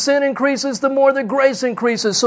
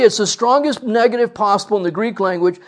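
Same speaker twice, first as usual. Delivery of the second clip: normal talking voice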